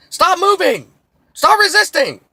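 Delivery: very high, loud screamed orders; mock cop yell. Cop yelling at a man who isn't moving, high loud screamed. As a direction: scream them like a cop before six shots. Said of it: "cop commands before shots".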